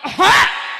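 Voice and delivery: loud, sudden mock cough; acted cough. Preacher acting the coughing father, one loud cough. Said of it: "Bapakne watuk".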